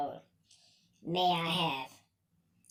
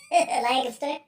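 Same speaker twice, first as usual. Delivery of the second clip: higher questioning repeat through a laugh; 6 semitones higher, slower than usual. Real, not acted. Hometown local repeating the name, laughing. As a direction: chuckle the word out, rising at the end.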